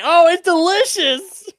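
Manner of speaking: very high, loud and slow mock delight; squealed pretend enthusiasm; hammed-up praise. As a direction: overdo the compliment to appease the cook. Acted forced praise of bad food.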